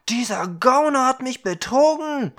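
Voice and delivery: furious at being cheated; angry scolding. Acted. Young man cursing the dwarf who cheated him, loud and furious. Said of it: "schimpfte er".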